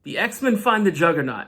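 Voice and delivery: ordinary delivery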